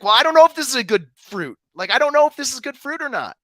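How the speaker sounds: high, loud, mock-baffled; confused believer. Mocking a believer unsure of good fruit, high and loud. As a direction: play the doubter being clueless.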